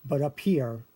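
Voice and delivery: ordinary talk, nothing marked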